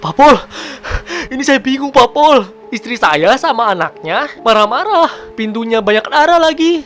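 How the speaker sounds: high, gasping cries with broken pauses; hammed-up panic, catching breath between phrases; mock panicked caller. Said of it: "sambil nada gini". (panik dibuat-buat, terengah-engah dengan suara tinggi)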